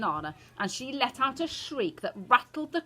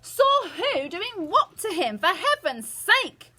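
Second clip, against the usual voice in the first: high, loud, drawn-out bluster; father at the end of his patience; exasperated shout; 13 semitones higher, 8 dB louder, much slower than usual. A narrator's reading. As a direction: shout in baffled outrage. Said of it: "shouted Mister Jenkins".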